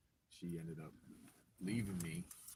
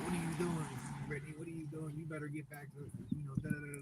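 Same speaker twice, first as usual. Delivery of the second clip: scolding sister; 8 semitones higher than usual. Retold or imitated. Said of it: "my sister's like".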